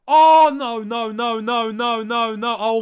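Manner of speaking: loud, high cries of no; real dismay, shouted; anguished shout. Real, not acted. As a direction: yell at the screen as the chance goes by. De Bruyne chance, fan in dismay.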